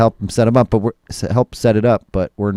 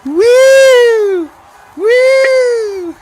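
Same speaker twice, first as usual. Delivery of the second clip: very high, loud whooping woo; high woo cheer; 4 dB louder than usual. Shown as a demonstration. Demonstrating the stadium cheer, loud high whoop. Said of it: "No, no, it wasn't woo-woo".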